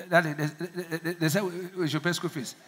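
hesitant stammer, afraid to ask; a stranger's child nervously asking for fees; timid stammering. Shown as a demonstration. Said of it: "you would say, like, uh, uh".

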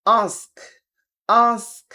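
British "ask" with open AH; British accent. Shown as a demonstration. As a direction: drill "ask" British style, AHSK. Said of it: "ব্রিটিশে বলে". (British উচ্চারণে)

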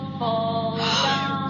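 sharp frightened gasp; sudden gasp of fright. Acted. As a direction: gasp in shock at the road. Sudden terror in car.

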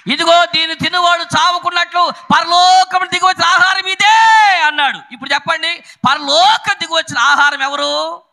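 high, loud, ringing sermon delivery; high-pitched rallying preacher; full-force preaching. Real, not acted. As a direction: proclaim it to the congregation, drawing out key words.

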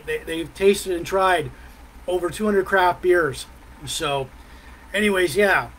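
ordinary talk

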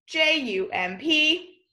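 slow letter-by-letter spelling; slow spelled letters. Shown as a demonstration. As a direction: spell each letter slowly. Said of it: "spelling the word aloud".

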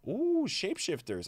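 surprised. Real, not acted. Sees new promo reward.

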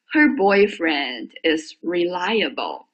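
reliable read slowly, stress on LI; teacher modelling the sentence slowly; slow demo reading. Shown as a demonstration. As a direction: Her boyfriend is reliable said slowly, second syllable of reliable stressed. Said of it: "我给你读一下啊".

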